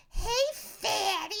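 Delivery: high, drawn-out taunting call; imitated jeer from the crowd; background heckler. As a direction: call it out mockingly after someone running off.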